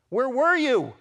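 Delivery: loud high cry to God; anguished shout. Acted. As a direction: cry it out in pain.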